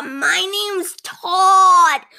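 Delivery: high, loud, slow sugary Todd; overly cheerful cashier. Acted. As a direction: stretch "Todd" out sweetly and loudly. Cashier introduces himself.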